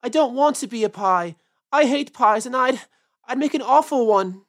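frightened little rabbit protesting; a scared bunny pleading loudly; wailing. Acted. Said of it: "wailed Peter suddenly".

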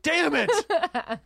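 high, drawn-out comic wail; mock exasperation. Friend phoning to check for depression, mock exasperated. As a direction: stretch it out in hammed-up frustration.

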